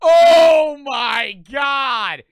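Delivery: huge, high, drawn-out wail; gasp, then scream it out long and loud; hammed-up shriek of horror; mock terrified scream. Mock scream of the shrunken man.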